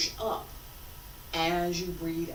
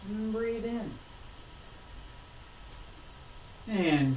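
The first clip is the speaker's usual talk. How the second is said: guided breathing cues; 3 semitones lower, 9 dB quieter than usual. Acted. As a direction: lead the class through each breath.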